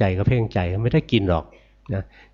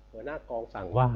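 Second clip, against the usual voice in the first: softly; 2 semitones higher, 9 dB quieter than usual. Retold or imitated. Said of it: "เราก็พูดเบาๆ".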